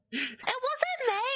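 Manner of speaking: put-on very high, drawn-out Scottish little girl; squeaky put-on girl's denial. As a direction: plead innocence in a tiny high child voice. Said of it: "the little girl goes".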